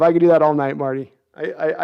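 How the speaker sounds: ordinary delivery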